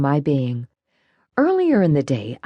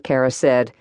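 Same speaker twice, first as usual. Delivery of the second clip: ordinary delivery, nothing marked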